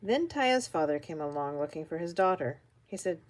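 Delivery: ordinary delivery, nothing marked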